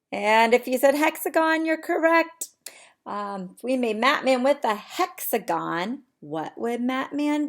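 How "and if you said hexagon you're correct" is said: higher, louder, cheering them on; excited praise. Real, not acted. Teacher praising her class's answer, excited. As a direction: reward the right answer with energy.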